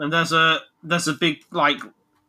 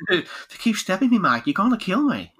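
higher-pitched mild Australian impression; Australian accent; 6 semitones higher than usual. Quoting the stabbed man in the film, Australian accent. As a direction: put on a casual Australian voice. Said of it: "Oh, Australian".

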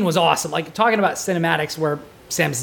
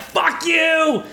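angry yell; 8 semitones higher than usual. Retold or imitated. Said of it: "I'm like".

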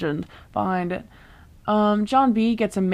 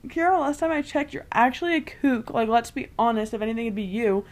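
sassy comeback; 5 semitones higher, 4 dB quieter than usual. Retold or imitated. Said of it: "And it's like".

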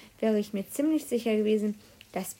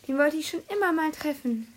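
lightly sighed, a bit higher; dreamy sigh; 6 semitones higher than usual. A narrator's reading. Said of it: "seufzte Tina". (verträumt seufzend, leicht höher)

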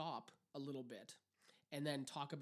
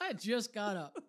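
loud, raised-pitch complaint; mock indignant yell; 6 semitones higher, 9 dB louder than usual. Host playing a grumpy breakfast eater, indignant yell. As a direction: repeat the protest with comic outrage. Repeats the acted breakfast protest.

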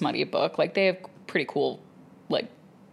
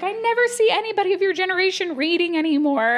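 chatty flight attendant; 13 semitones higher than usual. Retold or imitated. Flight attendant marvelling at a young reader, high. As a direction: keep up the gushing stranger voice. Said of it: "She was like".

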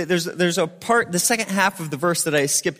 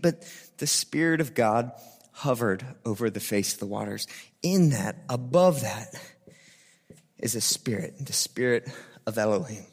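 hushed reverence; 8 semitones lower, 6 dB quieter than usual. Acted. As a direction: drop to a reverent hush.

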